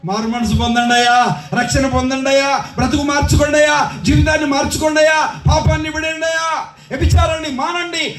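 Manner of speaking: rhythmic, climbing chain of appeals; exhorting preaching. Acted. Preacher urges people to repent and quit sin, exhorting preaching. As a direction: plead line after line in sermon cadence.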